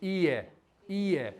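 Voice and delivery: I, eh run together quickly as one word; quick natural demo; said fast. Shown as a demonstration. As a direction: say I, eh fast, blending the sounds. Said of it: "Say it fast".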